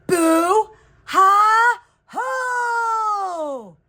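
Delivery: high, loud howling ghost; ghostly howl. A narrator's reading. Ghost howls to scare the unbothered girl, high and loud. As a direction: howl each word out to spook. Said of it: "howled Cuco".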